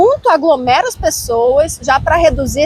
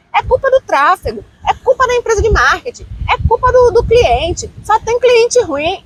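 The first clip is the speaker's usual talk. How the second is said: high-pitched mock complaint; blaming others; 8 semitones higher than usual. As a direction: imitate someone passing the blame. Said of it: "é muito mais fácil a gente dizer".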